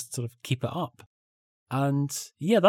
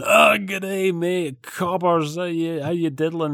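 put-on higher, louder phony Australian accent, slowing on the slang; mock Australian accent; 6 semitones higher, 3 dB louder, slower than usual. Colleague back from a year in Australia showing off, hammed-up mock Australian accent. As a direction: ham up a fake Australian accent like a show-off. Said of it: "this phony accent".

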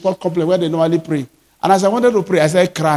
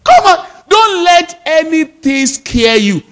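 preaching exhortation; 9 semitones higher, slower than usual. Real, not acted. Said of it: "preacher exhorting".

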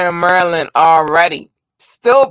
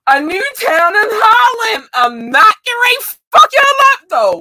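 much higher, louder squealed line; excited squeal; 4 dB louder than usual. Real, not acted. Streamer reading a new town, squealing with joy. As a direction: squeal it in delight.

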